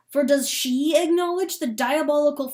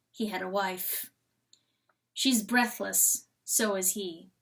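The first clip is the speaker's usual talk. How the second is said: ordinary delivery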